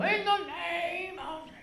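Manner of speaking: high, loud and slow like a big showy prayer; mock loud prayer. The prayer Bill did not pray, mockingly hammed up. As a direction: ham up a booming healer's prayer. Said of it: "Bill Johnson didn't go".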